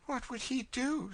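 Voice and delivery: very high, anxious question; breathless interest. A narrator's reading. Girl asking what the master would do, breathless interest. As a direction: ask it high, hanging on the answer. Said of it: "looking with breathless interest".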